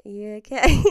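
loud, startled reply; a loud worried "are you okay"; concerned shout. Retold or imitated. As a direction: call out in alarm. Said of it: "she was like".

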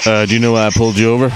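brisk police officer at a traffic stop; cop voice. Pretend. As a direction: rattle it off fast like a cop. Playing cop pulling driver over.

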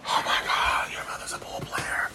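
awed whisper; soft, voiceless gasp of wonder; hushed amazement. Real, not acted. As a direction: whisper it in disbelief, very softly. Hushed amazed reaction to a find.

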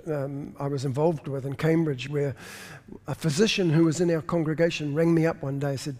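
usual speaking voice

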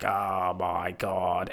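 mock dismay. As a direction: sink into despair, drawn out. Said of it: "And that's just like".

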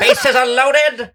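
baseball announcer. Pretend. Commentator calling a big at-bat, mock announcer voice. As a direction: stretch it out like a hyped-up play-by-play man.

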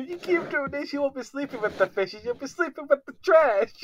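high-pitched mock Italian-American mobster; hammed-up Italian New York menace, near laughing; Italian New Yorker. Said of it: "We need an Italian New Yorker".

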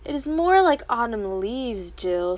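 cold, correcting tone; coldly. A narrator's reading. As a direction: put her in her place. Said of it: "said her mother coldly".

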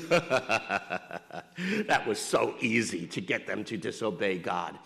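low, slow, laughing devil voice; gloating devil. Acted. Satan gloating over Adam and Eve, low laughing drawl. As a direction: chuckle it out low and slow, pleased with the trick. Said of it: "his response was simply".

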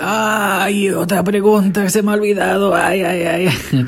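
high, fussy put-upon voice, trailing into slow sighing ays; imitating the dubbed detective, last ays drawn out slowly; Colombo's Spanish dub voice. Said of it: "Con esa voz que lo doblaron aquí".

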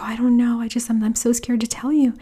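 whispered fast and high, almost afraid to say it; hushed whisper. Retold or imitated. Telling her husband what happened, in a fast, high whisper.

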